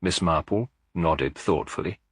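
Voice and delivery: usual speaking voice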